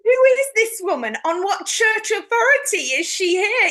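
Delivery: loud, high mock indignant priest. Priest angered by the woman in the monastery, loud. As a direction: voice the affronted priest. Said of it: "he's going".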